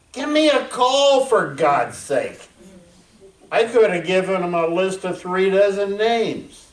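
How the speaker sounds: bursting out in disbelief at the researchers; exasperated outburst. Real, not acted. Veteran scoffing at a study that missed them, loud and exasperated.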